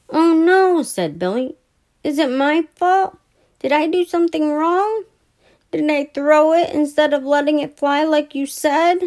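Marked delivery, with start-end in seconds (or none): 0.1-0.8 high, loud oh no; dismayed boy. A narrator's reading. Billy seeing the model wrecked, dismayed. Plane crashes. 2.0-9.0 put-on high anxious child voice; plead, afraid it's your fault; worried boy. A narrator's reading. Billy asking Brother whether he broke the plane, worried.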